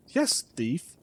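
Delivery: usual speaking voice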